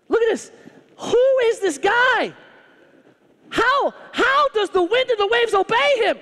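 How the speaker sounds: high, loud amazed disbelief; can't believe what just happened; astonished. Retold or imitated. Disciples marvelling that the wind and waves obey Jesus, stunned and loud.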